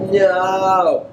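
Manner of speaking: a sudden high, loud "No!"; mock shout. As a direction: yell it out like a defeated villain. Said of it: "Thanos is all like".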